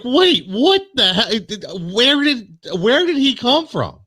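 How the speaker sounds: high-pitched, drawn-out disbelief; incredulous outburst, acting out being stunned; shocked. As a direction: re-enact your shock, voice way up high. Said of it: "I was shocked when I seen".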